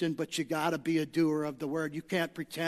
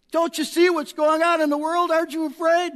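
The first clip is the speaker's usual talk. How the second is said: mock alarmed questioner; 10 semitones higher, 8 dB louder than usual. Voicing a fearful voice.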